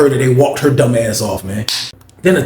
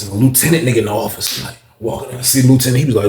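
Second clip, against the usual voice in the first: normal talking voice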